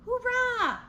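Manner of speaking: high, bright hoorah; excited cheer. A narrator's reading. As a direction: cheer it out to the kids. Cheer inside the letter.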